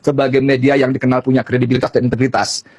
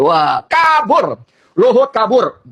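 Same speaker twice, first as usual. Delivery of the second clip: retold exclamation; 6 semitones higher, much slower than usual. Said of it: "kata Sanana Gusmao".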